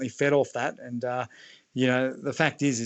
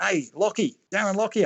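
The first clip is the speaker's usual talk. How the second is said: higher-pitched imitation of a fan's call; retold yell; 7 semitones higher than usual. Retelling a bloke mistaking him for Lockyer, high mock yell. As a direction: mimic a bloke hailing a footballer in the street. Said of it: "And he yells out".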